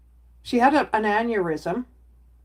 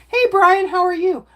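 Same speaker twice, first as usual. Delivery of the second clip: much higher and louder, bright hello; cheery greeting; 10 semitones higher, 5 dB louder than usual. Real, not acted. Greeting Brian in her chat, high and loud. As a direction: call out to a viewer with a burst of cheer.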